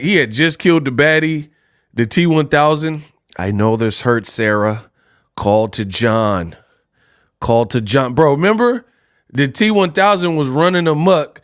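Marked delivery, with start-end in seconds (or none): 3.4-8.1 low, slow, deliberate menace; lightly voiced cold machine, low pitch; T-1000. Retelling the knife scene from Terminator 2, low slow imitation. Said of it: "Arnold was like".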